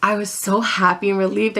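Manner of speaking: usual speaking voice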